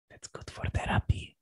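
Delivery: soft joking whisper; whispered aside. Real, not acted. Speaker jokes to the group in a whisper. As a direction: whisper it like a secret.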